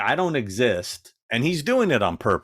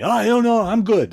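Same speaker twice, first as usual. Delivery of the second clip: higher, louder dismissive fan; mocking the rude fan; 6 dB louder than usual. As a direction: wave her off and fixate on the star. Fan dismissing her again.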